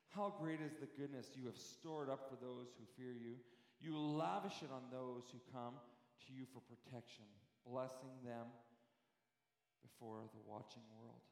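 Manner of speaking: hushed Bible reading; scripture reading. Acted. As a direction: read the verse gently and reverently. Reads the psalm aloud.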